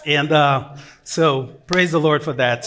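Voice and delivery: normal talking voice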